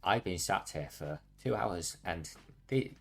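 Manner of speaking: usual speaking voice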